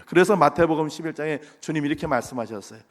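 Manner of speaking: ordinary talk, nothing marked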